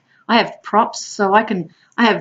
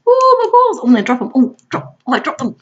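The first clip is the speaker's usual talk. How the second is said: high loud silly dismay; very high, loud clowning fuss; clown dropping balls; 7 semitones higher, 6 dB louder than usual. Retold or imitated. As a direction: ham up the clumsy juggler. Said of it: "So I play like that".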